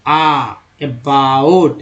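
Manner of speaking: आ drawn out low and slow before अबाउट; slow drawn out vowel. Shown as a demonstration. Teacher to students, slow vowel demo. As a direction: stretch the आ in अबाउट slowly. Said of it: "ओ का उच्चारण".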